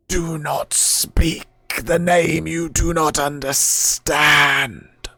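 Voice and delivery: slow, loud warning from beyond; menacing spirit voice. Acted. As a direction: warn them off like an ancient guardian. Spirit warns at the name.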